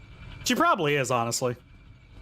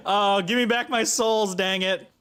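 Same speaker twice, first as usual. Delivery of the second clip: usual speaking voice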